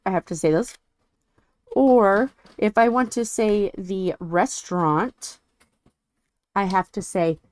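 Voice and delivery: ordinary talk, nothing marked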